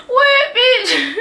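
a cracked-up shriek at her own words; laughing outburst. Real, not acted. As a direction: squeal it high through a laugh, half crying with laughter. Laughing shocked outburst at own joke.

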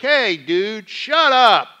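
scolding shut up. Retold or imitated. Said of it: "he's like".

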